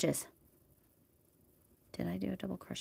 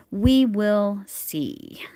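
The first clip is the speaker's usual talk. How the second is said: very slow, loud "We will see"; drawn out; 3 semitones higher, 7 dB louder, much slower than usual. Real, not acted. Unsure the yarn will last, drawn-out "We will see". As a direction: stretch each word of "We will see". Repeats it, second time drawn out.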